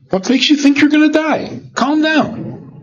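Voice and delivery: imitating a scoffing friend; mock dismissive. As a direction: brush off the fear like a sceptic. Voicing a scoffer's retort.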